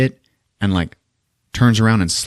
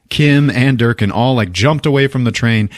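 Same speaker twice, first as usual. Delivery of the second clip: normal talking voice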